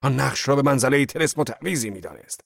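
loud voice. A narrator's reading. Angry Zorba boasting to his boss, booming loud. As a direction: declare it like a battle boast. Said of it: "با صدای بلند گفت".

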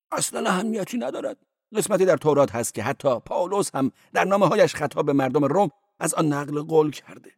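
high-voiced cleric. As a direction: play the cleric high and quick.

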